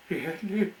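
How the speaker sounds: high hopeful question; hopeful surprise. Acted. The engine asks Mister Woody, high and hopeful. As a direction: brighten up a little.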